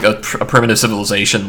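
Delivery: usual speaking voice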